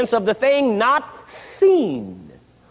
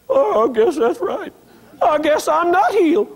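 higher whimpering voice, hammed-up; mock tearful dejection; 4 semitones higher than usual. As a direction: whimper in pretend defeat, ending in fake sobs. Acting out a discouraged, doubting believer.

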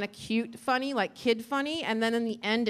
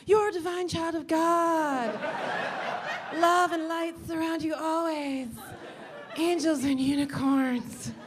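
high, slow, drawn-out angelic chant; blissful affirmation voice; 6 semitones higher, slower than usual. Acted. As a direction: float each line out slowly and high. Voices blissful affirmations in her head.

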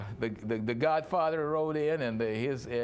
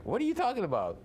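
high-pitched, baffled question; bewildered Jacob; 8 semitones higher, faster than usual. Acted. Jacob answers a baffling accusation from Laban, bewildered. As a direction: act out an incredulous reply. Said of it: "you can see Jacob's like".

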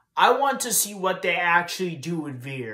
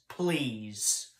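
soft final plea; 5 semitones lower, 6 dB quieter than usual. Real, not acted. Fan quietly begging WWE at the end, resigned. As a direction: lower and softer, a resigned please.

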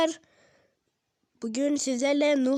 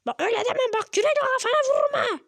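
high-pitched character voice; high, mock-menacing comeback; Mortis threatening; 10 semitones higher, faster than usual. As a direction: threaten back in a high, put-on voice. Mortis threatens his scolding partner.

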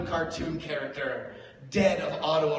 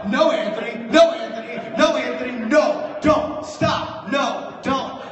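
loud, much higher, rapid no's; panicked pleading; 8 semitones higher, 10 dB louder than usual. Retold or imitated. Girlfriend yelling at Anthony to stop, mock panic. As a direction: shout her down the stairs.

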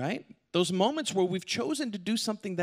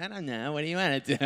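mock dopey reply; 3 semitones lower, 3 dB quieter, faster than usual. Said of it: "She really didn't talk that way".

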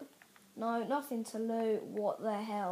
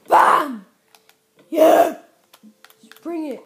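very loud, higher victory yell; triumphant shout; 6 semitones higher, 21 dB louder than usual. Real, not acted. Gamer beating a trap, loud victory yell. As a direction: yell it like you just won.

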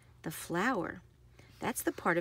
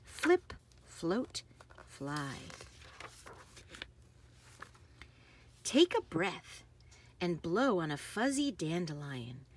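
lively, sing-song picture-book read; bouncy storybook narration with playful sound words; reading to children; 2 semitones higher than usual. Acted. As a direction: read it like bedtime story time for little kids. Reads picture book aloud.

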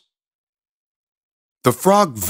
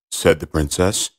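usual speaking voice

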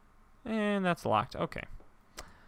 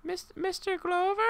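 much higher, lilting nurse call. Pretend. Player voicing a nurse waking Mister Glover. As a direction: call him gently like a nurse.

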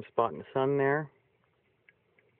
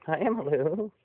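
bright high call of hello to the animal; high loud pet greeting; 8 semitones higher, 5 dB louder, slower than usual. Real, not acted.

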